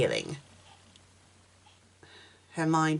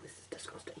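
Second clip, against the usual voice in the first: whispering; 28 dB quieter, much faster than usual. Real, not acted. Whispered aside while reading.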